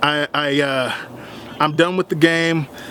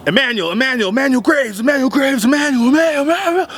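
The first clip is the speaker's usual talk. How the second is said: high, repeated name-calling; imitated yelling; 9 semitones higher than usual. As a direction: call his name over and over, pitch climbing higher. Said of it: "he's yelling, yelling my name".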